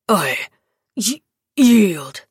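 stammered, spat-out surrender; grudging broken yield. A narrator's reading. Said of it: "the Dragan spat".

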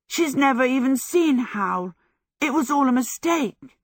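high, delighted explanation; elated outburst. A narrator's reading. Michael spins Sophie round explaining the mistake, elated high voice. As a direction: pour out the good news. Michael joyfully shouts his news.

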